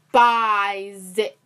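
slow, loud "despise" stretched out; emphatic. Real, not acted. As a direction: lean hard on "despise". Hates running.

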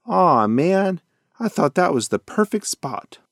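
high, overacted disappointment; acting voice. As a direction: ham up the letdown. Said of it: "in his best acting voice said".

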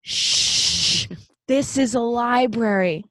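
loud mock shush, then drawn-out scolding; scolding old librarian. Old man librarian to crying boy, mock scolding. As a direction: imitate a stern librarian shushing someone. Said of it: "a old man librarian finds him".

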